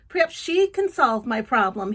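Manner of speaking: ordinary delivery, nothing marked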